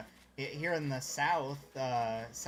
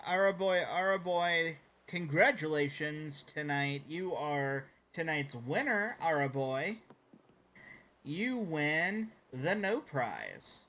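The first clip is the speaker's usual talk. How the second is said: louder game-show announcer voice, slow and drawn out; mock announcer; 3 semitones higher, 4 dB louder, slower than usual. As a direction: crown the winner like a TV host.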